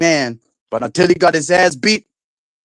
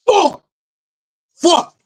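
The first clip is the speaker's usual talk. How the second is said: frustrated outburst. Real, not acted. Streamer blowing up at his router, high frustrated yelp. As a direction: cry out at the broken Wi-Fi.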